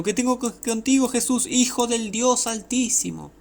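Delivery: very high strained cry; acted high-pitched outcry, drawn out at the end; shouting. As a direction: cry it out high as the possessed man.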